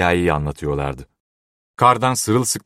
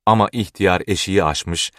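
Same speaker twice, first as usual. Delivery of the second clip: plain reading voice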